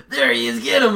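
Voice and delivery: high, loud shout of a gang member; mock yell. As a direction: yell it like you just spotted him. Gang members spotting him in game.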